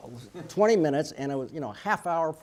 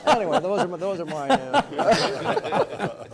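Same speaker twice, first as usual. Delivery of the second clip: higher, louder laughing words; 11 semitones higher, 9 dB louder, slower than usual. Real, not acted. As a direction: laugh out the wrap-up. Laughing at own punchline.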